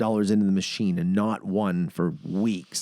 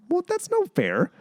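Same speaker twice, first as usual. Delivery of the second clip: mock whine; 6 semitones higher than usual. Whining at the rigged machine.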